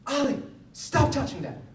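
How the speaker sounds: high, loud imitation of an angry kid; angry yelling. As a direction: yell at a little brother. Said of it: "he sounded so angry".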